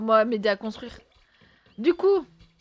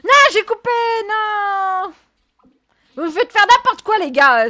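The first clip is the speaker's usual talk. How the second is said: high, loud exasperated outburst; pitched-up loud complaint to viewers; loud protest; 9 semitones higher, 7 dB louder than usual. Real, not acted. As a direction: yell it at chat, half laughing. Streamer protests at chat.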